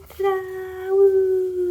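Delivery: high, loud ta-da and woo; excited cheer. Real, not acted. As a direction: cheer the big reveal. Reveal cheer.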